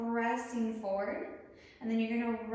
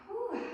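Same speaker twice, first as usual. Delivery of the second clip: relieved whew; 10 semitones higher than usual. Real, not acted. Relief after releasing arms.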